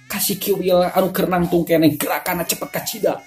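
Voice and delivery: plain reading voice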